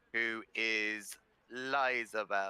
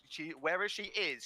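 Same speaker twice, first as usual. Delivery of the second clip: ordinary talk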